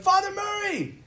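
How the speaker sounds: high, bright-pitched hail to a passerby; calling out greeting. Retold or imitated. Students greet their old teacher as he walks by, calling out.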